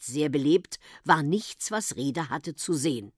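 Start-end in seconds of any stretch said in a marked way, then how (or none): none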